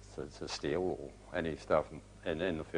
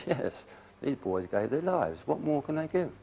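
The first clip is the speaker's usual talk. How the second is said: indignant retort; 9 semitones higher than usual. Retold or imitated. Veteran retelling his plea to an official, rising indignantly. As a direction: press the point, voice climbing. Arguing for a medal for dead comrades.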